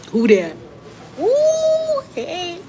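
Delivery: a nosy neighbor peeking out, then a sudden squeal; pry like the neighbor at the window, loud then shooting way up; playful nosy-neighbor bit, a loud call then a squealed ooh. Pretend. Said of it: "The nosy neighbor".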